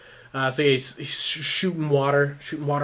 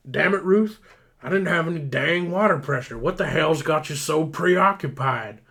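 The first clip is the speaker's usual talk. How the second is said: loud, higher-pitched exasperated rant; acted angry scolding; 4 semitones higher, 6 dB louder than usual. Benny confronts Ruth about water pressure, acted anger. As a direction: demand an explanation, hammed-up.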